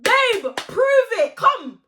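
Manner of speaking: very high put-on voice; mocking squeal. Teller mocking the challenge, very high mock voice. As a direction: squeak it in mockery.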